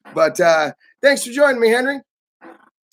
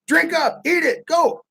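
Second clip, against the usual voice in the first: high, insistent mock host; host pushing a guest to drink, high; relentless urging; 9 semitones higher than usual. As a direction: keep pressing him to drink. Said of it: "they're relentless".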